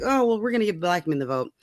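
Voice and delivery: ordinary talk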